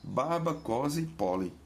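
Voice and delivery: baba, kozy, pole said as Czech, first syllables stressed; Czech example phrase. Shown as a demonstration.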